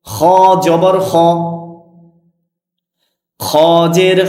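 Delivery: slow, measured teacher's chant; spelling drill recitation. Shown as a demonstration. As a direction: recite each letter deliberately, vowel drawn out. Leads a letter-spelling drill.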